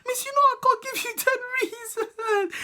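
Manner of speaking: high, pleading voice; hammed-up helpless kid; mock whiny plea. Pleading with teacher.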